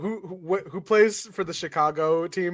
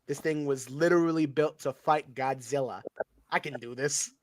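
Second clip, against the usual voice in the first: Chicago voice; 4 semitones lower, 7 dB quieter than usual. Pretend. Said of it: "is my Chicago voice".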